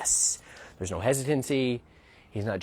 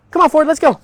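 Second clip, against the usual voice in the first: loud, high "come on, Ford, let's go"; trainer's bright go command; firm upbeat command; 13 dB louder than usual. Real, not acted. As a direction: call the dog forward with energy. Commanding the dog.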